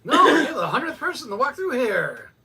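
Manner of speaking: loud, high, laughing announcement; mock prize announcer. Friend playing the cop, loud, high and laughing. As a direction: hype it like a prize giveaway.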